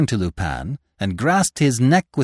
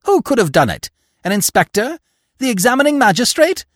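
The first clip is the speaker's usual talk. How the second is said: high, loud, rising questions; blurted disbelief; 11 semitones higher, 5 dB louder, faster than usual. A narrator's reading. Aunt guesses who emptied the gun, blurting in alarm. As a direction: fire off startled guesses, climbing higher. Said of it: "she blurted out".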